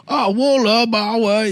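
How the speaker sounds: high, slow mocking gibberish voice; mocking fake Chinese. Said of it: "making fun of".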